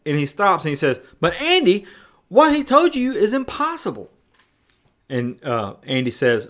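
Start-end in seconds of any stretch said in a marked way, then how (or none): 1.2-4.1 high-pitched incredulous protest; freaking out. Retold or imitated. Friend arguing with the father, freaking out. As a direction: imitate someone flustered and disbelieving. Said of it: "Barney starts freaking out".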